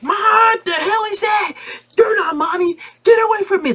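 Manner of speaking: put-on high shrieking frightened kids; screaming kids. Terrified kids yelling at the stranger mom. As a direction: shriek it up high, crying in fear.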